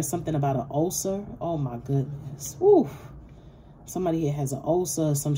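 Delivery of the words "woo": sudden high, loud woo; a startled, high whoop. Real, not acted. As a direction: let out a sharp whoop of shock. Reacting to a sensed ulcer.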